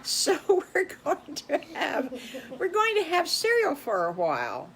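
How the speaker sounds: put-on lively read-aloud for children; storytelling voice. A narrator's reading. Host telling the boys and girls a story, animated. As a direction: tell it like a kids' show host.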